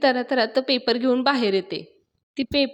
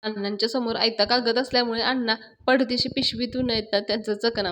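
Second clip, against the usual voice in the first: ordinary delivery, nothing marked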